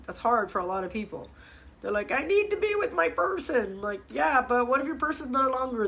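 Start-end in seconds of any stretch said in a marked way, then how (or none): 2.1-3.8 higher-pitched imitation of needy partners; put-on high, needy insistence; mock clingy plea. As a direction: plead it as a mock dependent partner. Voices people who can't detach.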